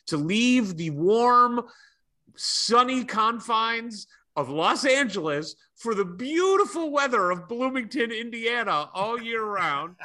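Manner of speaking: high, slow mock-grand delivery; sarcastic grandeur. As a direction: oversell the weather with irony. Sarcastic praise of Bloomington weather.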